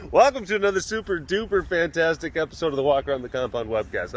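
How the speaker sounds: loud, high, exuberant host greeting; show host intro. Acted. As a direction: open the webcast with big announcer energy. Performs webcast opening.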